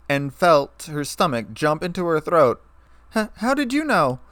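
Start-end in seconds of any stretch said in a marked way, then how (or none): 3.1-4.2 much higher, flustered stammer; embarrassed stammer. A narrator's reading. Shy mare caught out by her friend, embarrassed. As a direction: stumble on the first word, voice jumping high.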